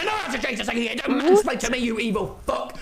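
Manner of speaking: high-pitched indignant rant; mock angry outburst. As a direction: snap back in exaggerated outrage.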